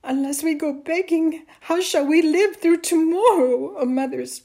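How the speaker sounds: high, loud anguished lament; weeping. A narrator's reading. Mother fears they must beg, weeping. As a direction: wail the worry, building. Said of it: "Amal's mother wept".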